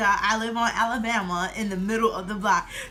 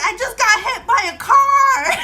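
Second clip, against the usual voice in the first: much louder and higher, slow and urgent; frantic announcement; 11 semitones higher, 10 dB louder than usual. Retold or imitated. Girl bursting into mom's room announcing the accident, loud and high. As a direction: burst in with the news, pitched up. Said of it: "And I was like".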